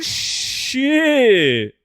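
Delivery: drawn-out high shocked curse; stunned, high-pitched curse. Real, not acted. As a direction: gasp it out, stunned by the gift. Reacts to a big gift.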